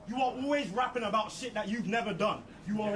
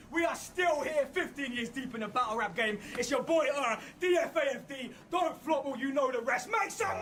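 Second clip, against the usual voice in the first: hype announcer; 8 semitones higher than usual. Real, not acted. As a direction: shout it out to the crowd. Host hypes the event.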